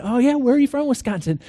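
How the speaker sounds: high-pitched, chatty retold questions; eager interest. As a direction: pounce on it with keen curiosity. Acting out his eager small talk.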